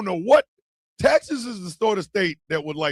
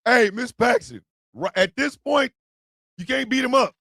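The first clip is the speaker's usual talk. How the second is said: normal talking voice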